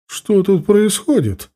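high, slow, strict demand; well-projected, drawn-out stern question; stern commanding voice. A narrator's reading. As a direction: demand an explanation with stern authority. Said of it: "Раздался громкий, хорошо поставленный, строгий голос".

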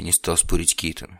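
plain reading voice

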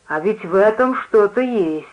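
usual speaking voice

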